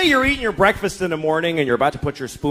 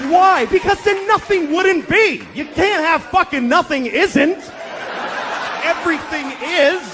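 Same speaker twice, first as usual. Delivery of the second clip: spiral into comic madness; frantic rising yell; 10 semitones higher, slower than usual. Retold or imitated. Father losing it to daughter.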